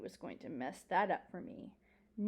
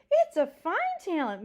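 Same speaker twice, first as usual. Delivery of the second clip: loud, high, emphatic approval; delighted praise; 9 semitones higher, 11 dB louder than usual. Acted. Old librarian admiring the dog's talent, delighted. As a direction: praise it with hearty relish.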